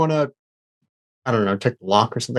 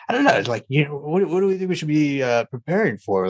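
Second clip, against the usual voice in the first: ordinary talk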